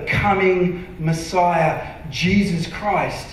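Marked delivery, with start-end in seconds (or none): none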